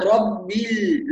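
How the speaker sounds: "رب ال" slow, "rab-bil" with a pure i; kasra into lam. Shown as a demonstration. Teacher models the join, slow رب ال with a clear i. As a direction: glide from bi straight into the still l of "رب ال". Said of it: "ننتقل من الباء المكسورة".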